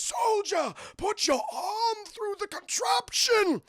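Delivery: very high, drawn-out villain command; the Doctor. Retold or imitated. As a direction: order it with shrieking hammy grandeur. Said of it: "he talks like this throughout the entire movie".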